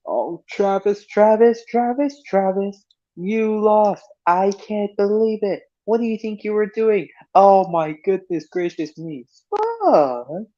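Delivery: high, mock-dismayed scarecrow voice; Spud scolding Travis. Spud scolds Travis for losing, high and dismayed. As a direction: act shocked, shaking your head. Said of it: "It goes like this".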